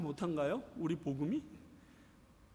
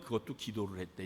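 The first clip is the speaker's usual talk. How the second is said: ordinary talk, nothing marked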